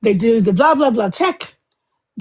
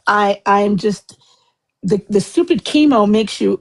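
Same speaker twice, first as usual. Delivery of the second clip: ordinary talk, nothing marked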